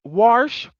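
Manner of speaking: WASH drawn out as "warsh"; slow WASH with an added R; intrusive R. Shown as a demonstration. Listing hated pronunciations, WASH as "warsh". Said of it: "where there's a R in wash".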